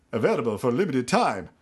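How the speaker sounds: lightly mocked commercial voiceover; boilerplate ad announcer. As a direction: imitate a canned TV-ad tagline. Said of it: "these boilerplate ads".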